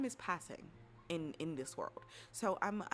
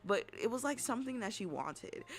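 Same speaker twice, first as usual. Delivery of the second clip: usual speaking voice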